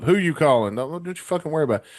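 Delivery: retold startled demand; alarmed dad. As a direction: imitate a dad jumping up in alarm. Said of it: "and he jumps up".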